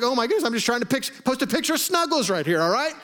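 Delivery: high, fast, laughing exasperation; exasperated. Retold or imitated. Poster fed up with critics, high and laughing. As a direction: throw up your hands, half laughing.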